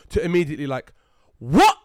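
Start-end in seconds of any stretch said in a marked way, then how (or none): 1.4-1.8 loud, high furious retort; reenacting losing his temper at police; angry outburst. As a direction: explode at the cops. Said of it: "which I shouldn't have done, but I lost my temper".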